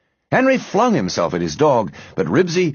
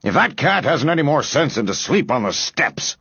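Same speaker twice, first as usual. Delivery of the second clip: irritated, higher-pitched snap; snapping; 6 semitones higher than usual. A narrator's reading. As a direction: snap crossly about the stupid cat. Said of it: "snapped Mister Grumby".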